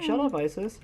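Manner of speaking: half-hearted yell at a pet; retold yell. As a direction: retell yelling at the cat, without full volume. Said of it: "I yell again".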